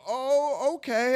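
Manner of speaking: high-pitched hesitant okay; uneasy agreement. Retold or imitated. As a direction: go along while unsure. Nervously agrees to drugs.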